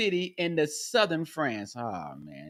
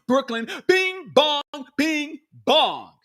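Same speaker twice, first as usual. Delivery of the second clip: higher, slower ding-dong; slow chime; 7 semitones higher, slower than usual. Pretend. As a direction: ring it slowly. Covid chime gag repeated.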